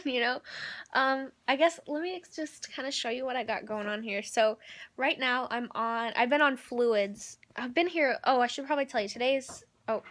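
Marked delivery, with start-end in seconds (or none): none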